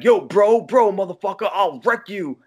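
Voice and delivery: high, loud mock Goodfellas tough-guy accent; pseudo Goodfellas accent. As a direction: ham up a fake Goodfellas accent. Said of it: "his fucking pseudo Goodfellas accent".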